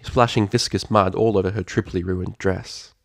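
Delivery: usual speaking voice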